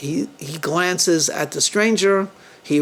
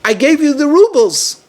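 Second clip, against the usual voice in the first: higher, louder, agitated plea; very emotional; 8 semitones higher, 5 dB louder than usual. A narrator's reading. Wronged guest confronting Shimon, very emotional. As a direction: plead with raised, emotional voice. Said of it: "very emotional".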